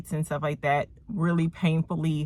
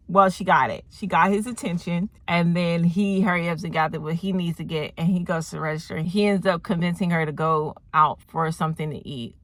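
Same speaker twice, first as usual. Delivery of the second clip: normal talking voice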